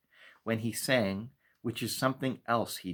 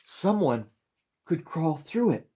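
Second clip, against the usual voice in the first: high, loud, gasping in spurts; young boy out of breath, blurting it out; breathless boy; 9 semitones higher, 6 dB louder than usual. A narrator's reading. Boy gasping out news of the hole to the maker, high breathless spurts. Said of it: "spoke in spurts as he gasped for air".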